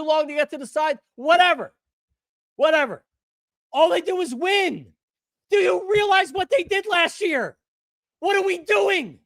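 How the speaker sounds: fired-up fan hollering at the doubters; ranting shout. Real, not acted. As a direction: yell it like you can't believe the doubters. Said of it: "Don't scream at us".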